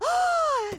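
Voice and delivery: sudden high gasp of discovery; sharp gasp. Acted. Mayor gasps, finding the statute in the book, sharp gasp. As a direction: gasp as the answer jumps off the page.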